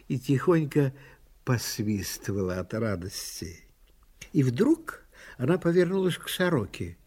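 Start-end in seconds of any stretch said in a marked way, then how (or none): none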